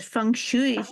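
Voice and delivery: "feng shui" said as "fung shoo-ee"; old mispronunciation. Retold or imitated. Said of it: "I remember people calling it".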